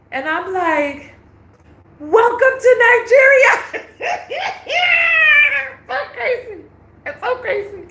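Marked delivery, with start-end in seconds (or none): 2.0-3.5 high, loud, hilarious announcement; big sarcastic shouted greeting; mock welcome shout. 3.6-5.8 long squealing laugh; helpless real laughter; high, loud laughing shriek. Real, not acted. Cracking up at own punchline. 5.9-7.8 high voice left over from laughing; amused, pitched way up; laughing disbelief. Real, not acted. Recovering from laughing fit.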